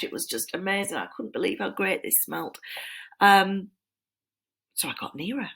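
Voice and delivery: normal talking voice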